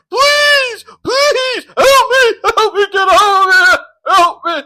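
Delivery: high, loud, desperate pleading yell; shrill mock scream of please help me. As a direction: ham up a stuck mate screaming to be rescued. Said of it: "screaming for me".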